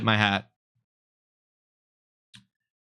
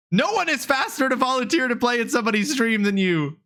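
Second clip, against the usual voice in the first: usual speaking voice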